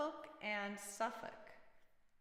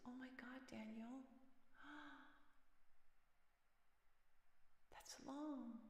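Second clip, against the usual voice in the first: whispered shock; 3 semitones higher, 14 dB quieter than usual. Real, not acted. Reacts to long hours, hushed.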